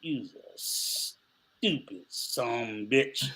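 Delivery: slow, low Southern sheriff drawl; imitating Jackie Gleason's sheriff. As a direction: drag it out like the frustrated sheriff. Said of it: "he'd be like".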